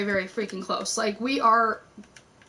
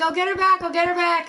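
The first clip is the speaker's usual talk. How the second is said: high, louder repeated assurance; retold frantic promise; 8 semitones higher, 6 dB louder, faster than usual. Woman voicing husband promising to get her friend back, flustered. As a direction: imitate a flustered, hurried promise.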